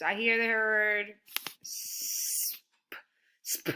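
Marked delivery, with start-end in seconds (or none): 1.6-3.7 sp hissed without voice; sp sounds. Shown as a demonstration. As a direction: breathe out the sp sound. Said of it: "I hear the S P".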